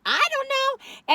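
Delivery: high, slow sing-song I don't know; playful drawn-out shrug. Real, not acted. Joking to viewers about possum pets, sing-song.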